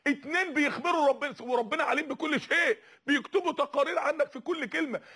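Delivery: ordinary talk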